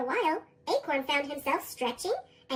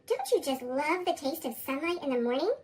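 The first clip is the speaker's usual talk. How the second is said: usual speaking voice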